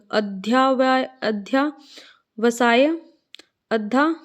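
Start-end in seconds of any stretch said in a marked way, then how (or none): none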